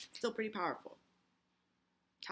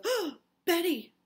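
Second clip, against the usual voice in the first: gasped, high loud cry of alarm; 10 semitones higher, 11 dB louder than usual. Acted. Abby's alarmed line, acted from play.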